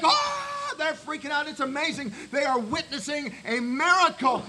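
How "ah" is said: freaked-out crowd scream. Retold or imitated. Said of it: "Everyone in the bar is like".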